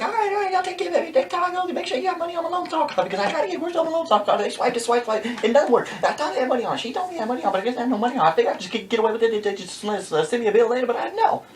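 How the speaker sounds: motor-mouth neighbor rambling on; super-fast talker. Retold or imitated. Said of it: "This woman talks super fast".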